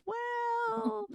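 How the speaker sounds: very high drawn-out well; teasing hesitation. Retold or imitated. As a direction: stall playfully before the truth. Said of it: "And he goes".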